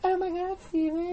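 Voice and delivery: high falsetto gushing; woman voice. Acted. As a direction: put on a high female voice. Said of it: "Nice woman voice".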